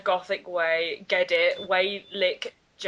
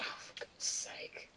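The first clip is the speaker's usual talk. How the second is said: whisper; 15 dB quieter than usual. Real, not acted. Exasperated aside.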